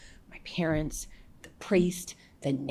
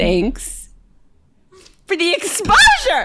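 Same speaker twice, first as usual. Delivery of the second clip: laughing shout; 13 semitones higher, 10 dB louder than usual. Retold or imitated. Retelling her punchline to the host, laughing shout.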